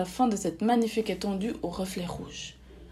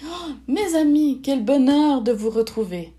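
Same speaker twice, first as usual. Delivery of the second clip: loud, high, delighted welcome; joyful greeting; 5 semitones higher, 7 dB louder than usual. A narrator's reading. Fairy reunited with her friends, joyful greeting.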